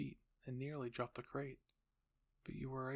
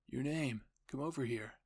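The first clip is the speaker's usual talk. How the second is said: louder call to someone a little way off; 6 dB louder than usual. A narrator's reading. Historia calls you over to walk, calling out. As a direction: call them over, raising your voice.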